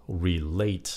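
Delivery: RELATE said as re-LATE; stress on second syllable. Shown as a demonstration. Teacher asks learners to hear re-LATE, second syllable stressed. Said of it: "nhấn âm hai".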